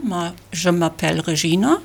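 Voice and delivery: ordinary talk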